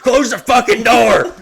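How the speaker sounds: drugged son yelling at his father; furious shout. Retold or imitated. Drugged friend snaps at dad.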